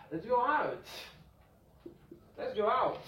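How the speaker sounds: bright suggestion; lifted example voice; example line. Shown as a demonstration. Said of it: "If I say".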